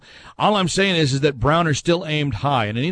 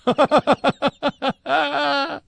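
high-pitched burst of real laughter; hearty laugh; 12 semitones higher than usual. Real, not acted. Host amused by a fan's joke, laughing hard.

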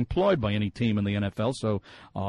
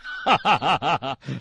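a run of chuckles; amused laughter. Real, not acted. Host joking about redcoats' weapons, amused laughter. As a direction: laugh along at the joke.

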